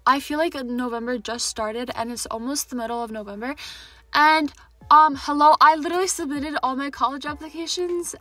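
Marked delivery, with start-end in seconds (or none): 4.1-8.1 excited. Real, not acted. Excited about college news.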